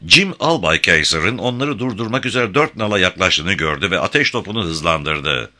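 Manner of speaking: plain reading voice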